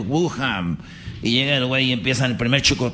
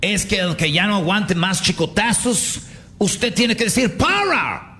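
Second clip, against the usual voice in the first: announcer stating rules; 4 semitones higher than usual. Acted. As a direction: lay out the rules, stretching each phrase. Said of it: "llega y le dicen".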